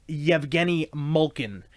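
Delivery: Yevgeny Malkin, slowly, with Y onset and MOLE-kin; the player's own pronunciation. Shown as a demonstration. Introducing a Russian player, Yevgeny Malkin slowly as MOLE-kin. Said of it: "the way he pronounces it is".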